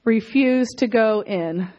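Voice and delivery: slow, deliberate call for the congregation to repeat; slow call phrase. Shown as a demonstration. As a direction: say "Refuse to go in" slowly with weight on "Refuse". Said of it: "Okay, everyone say".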